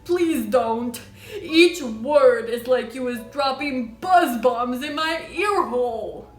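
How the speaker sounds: loud, slow, suffering giant; cried plea. A narrator's reading. Giant begs Sophie to spare his ear, crying out. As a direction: wail loudly, drawing the words out. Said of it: "cried the poor B F G".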